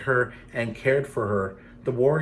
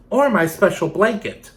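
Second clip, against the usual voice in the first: woman objecting; 8 semitones higher, 6 dB louder than usual. Acted. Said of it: "a woman".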